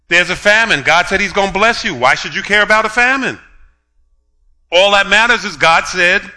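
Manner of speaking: insistent sermon delivery; rising preaching. Acted. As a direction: push the point home to the congregation. Preacher building his point.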